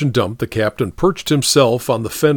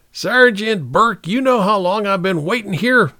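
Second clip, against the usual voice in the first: bellowing captain; 9 semitones higher than usual. A narrator's reading. Captain bellowing at his late sergeant. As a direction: roar it at the sergeant. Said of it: "he bellowed".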